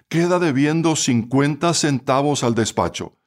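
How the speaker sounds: ordinary delivery, nothing marked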